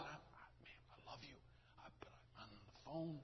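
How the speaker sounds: near-silent imitated whisper; very soft, mouthed-like plea; hushed reassurance. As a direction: whisper it so as not to disturb the call. Gentle phone reply amid her hollering.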